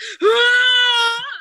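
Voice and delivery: high gasp breaking into a sob; sobbing gasp. Retold or imitated. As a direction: gasp as if bursting into tears. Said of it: "I just start sobbing".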